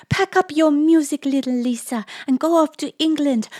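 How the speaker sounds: high, loud, rushing-on orders; excited instructions. A narrator's reading. Lisa quoting his orders to leave, high and excited. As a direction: send her off with loud high urgency.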